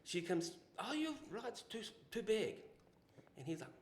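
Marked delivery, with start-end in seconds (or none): 0.8-2.5 high, lightly put-on Japanese accent; a quick mock Japanese accent; imitating Japanese accent. Retelling the Japanese lady's complaint, light Japanese accent. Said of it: "he's got a real thick accent".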